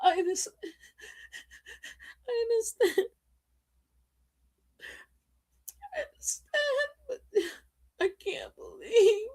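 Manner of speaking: high, soft, breathless sobbing whisper; hammed-up high tearful whisper; mock crying whisper. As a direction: play her weeping and broken, barely voiced. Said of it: "she's crying, she's like".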